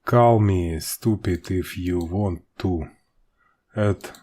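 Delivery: usual speaking voice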